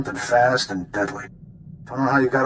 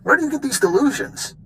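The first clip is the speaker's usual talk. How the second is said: mocking scorn; 13 semitones higher than usual. Skavik mocks the captain.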